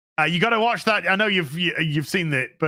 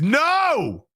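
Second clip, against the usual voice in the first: a sharp pitched-up shout of no; dismayed no; 8 semitones higher than usual. Real, not acted. Reacting to package in snow.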